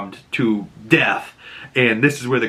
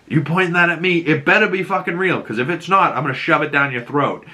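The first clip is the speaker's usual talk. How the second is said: higher-pitched tough-guy threat; mock menacing challenge, pitched up; acted threat; 6 semitones higher than usual. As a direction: play a man daring someone with a gun. Acted threat at gunpoint in retelling.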